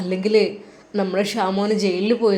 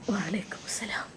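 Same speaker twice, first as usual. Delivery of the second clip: breathless whisper of shame; an ashamed old man whispering back; whispered reply; 2 semitones lower, 16 dB quieter than usual. A narrator's reading. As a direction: whisper the greeting, unable to face him. Ashamed man returns greeting.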